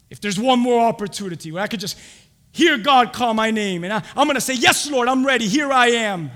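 loud, eager, rising declaration; full-force preaching. Real, not acted. Believer answering God's call, full-force preaching.

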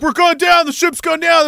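high, loud doomsayer cry; mock panic. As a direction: call out like the ship is sinking. Mimics panicked doomsayers on sinking ship.

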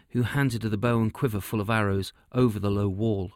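ordinary delivery

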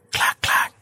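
whispered click-clack of a gun; gun cock noise. Retold or imitated. Joker cocking his pistol, whispered click-clack sound. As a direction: mimic a pistol being cocked.

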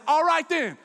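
loud, high defiant shout; defiant comeback. Retold or imitated. Kid to mom before getting out, defiant shout. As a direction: fling it back loudly.

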